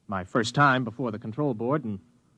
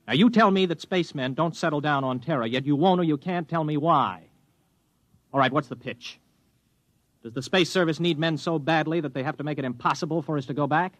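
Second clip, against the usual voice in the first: frustrated demand; 6 semitones higher than usual. Acted. Spaceman confronting the psych officer.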